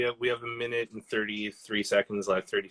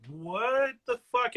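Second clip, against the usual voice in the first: stunned disbelief; 13 semitones higher, much slower than usual. Retold or imitated. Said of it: "Matt Damon was like".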